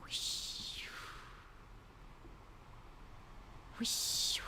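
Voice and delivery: soft breathy whoosh of a wave; hushed wave sound effect; whispered wave noise. Shown as a demonstration. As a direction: blow like ocean waves. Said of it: "listen to the waves together".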